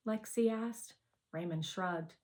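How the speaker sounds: ordinary delivery, nothing marked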